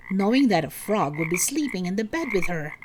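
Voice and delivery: usual speaking voice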